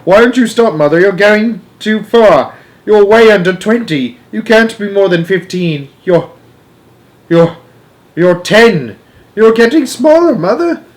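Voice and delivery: high, loud, mounting panic; shrill alarm. A narrator's reading. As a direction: plead with rising alarm, stumbling over words. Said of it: "a shrill note of alarm in her voice".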